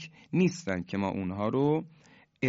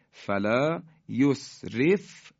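Quranic recitation; 3 semitones higher, much slower than usual. Shown as a demonstration. Teacher reciting the example verse, very slow chant. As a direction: stretch it out like a reciter. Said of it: "مثال بعدی".

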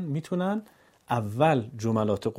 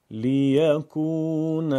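slow, louder chanted لیکون; joined recitation; 3 semitones higher, 4 dB louder, much slower than usual. Shown as a demonstration. Teacher reads the word joined after syllables. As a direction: say it whole and drawn out. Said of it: "پیوسته خوانی".

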